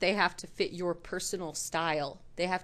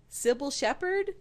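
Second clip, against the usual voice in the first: ordinary talk, nothing marked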